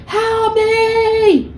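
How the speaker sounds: high, thin cry for help from far down a hole; hammed-up cry from the bottom of a pit; mock scream for help. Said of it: "They're screaming from the bottom of the hole".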